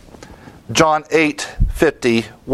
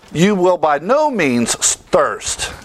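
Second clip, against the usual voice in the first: slow, leaning hard on "no"; emphatic double negative; 3 semitones higher, slower than usual. Shown as a demonstration. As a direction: drag it out, punch "no". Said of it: "It's emphatic in the Greek".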